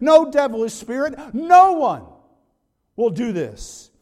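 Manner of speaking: loud, high, building list to a peak; forceful preaching. Real, not acted. Preacher telling the congregation nobody takes Christ's life, emphatic and mounting. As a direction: hammer out each item with mounting insistence.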